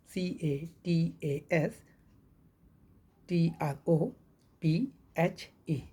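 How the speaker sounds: very slow letter by letter; spelling out letters. Shown as a demonstration. Teacher spelling catastrophe for students, letter by letter. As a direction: spell it out slowly, one letter at a time. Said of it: "ई लगा है".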